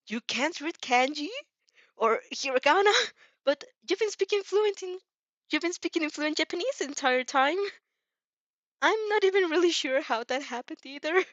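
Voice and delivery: put-on startled young hero, voice lifting in worry; Izuku voice. Izuku panicking about kanji.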